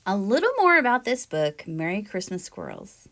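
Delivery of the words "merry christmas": plain reading voice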